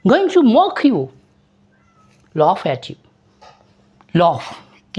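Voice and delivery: ordinary talk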